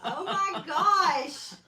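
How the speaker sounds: loud, high, drawn-out cry of amusement; amused outburst, very slow and high; laughing exclamation. Real, not acted. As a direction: stretch it out through real laughter. Laughing at the botched zipper.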